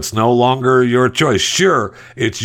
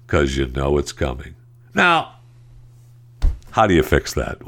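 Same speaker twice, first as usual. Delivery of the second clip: ordinary talk, nothing marked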